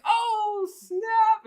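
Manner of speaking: very high, loud hammed-up squeal; mock whooping exclamation. Storyteller imitating the metro lady's reaction, hammed-up loud whoop. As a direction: imitate a stranger whooping in delighted shock.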